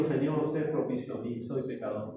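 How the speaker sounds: soft, low, broken-down confession; humble plea. Acted. The publican begging God for mercy, soft and low. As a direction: sink the voice as if ashamed before God.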